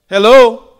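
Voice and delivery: loud, high call of hello. Real, not acted. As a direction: call hello loudly into the room. Preacher calls out to congregation.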